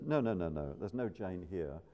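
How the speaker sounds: a hint of a gatekeeper angel saying no; angel refusing. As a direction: turn her away gently but firmly. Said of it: "The angel looks at the list".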